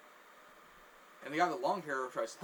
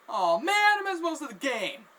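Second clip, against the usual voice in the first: high, loud lament; dismayed cry; 12 semitones higher, 11 dB louder than usual. Retold or imitated. Guy in the jersey realising he missed the game, dismayed cry. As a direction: wail it like a let-down fan.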